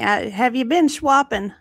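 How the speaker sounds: usual speaking voice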